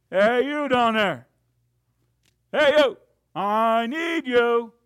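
slow, loud hollow call from above; mock calling out. Preacher mocking how God does not call, loud and slow. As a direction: boom it down like a distant summons.

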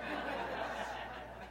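soft breathy chuckle; quiet laugh. Real, not acted. Preacher laughs quietly, a soft breathy chuckle. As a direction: let out a hushed laugh.

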